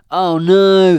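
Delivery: high, very loud cry of alarm; panicked cry. Acted. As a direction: cry out in sudden horror. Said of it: "they cried".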